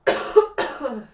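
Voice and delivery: loud hacking coughs; coughing fit. Real, not acted. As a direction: cough hard, throat tickling. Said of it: "I keep coughing".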